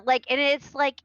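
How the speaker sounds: usual speaking voice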